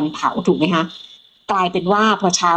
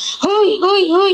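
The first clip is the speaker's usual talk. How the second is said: high, drawn-out alarmed calls; imitated clamour; 12 semitones higher than usual. Imitating panicked villagers heard from the boat, high alarmed calls. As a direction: mimic people crying out in commotion. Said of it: "เสียงคนโวยวายแบบ".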